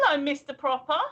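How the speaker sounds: ordinary talk, nothing marked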